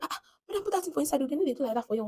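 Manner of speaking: high-pitched imitated protest; alarmed retold warning. Man warns woman to hide her dollars, alarmed. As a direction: urge her to put it away.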